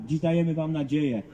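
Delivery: ordinary talk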